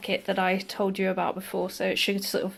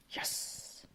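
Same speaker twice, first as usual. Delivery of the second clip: whispered yes; 18 dB quieter than usual. Real, not acted. Quiet triumphant whisper.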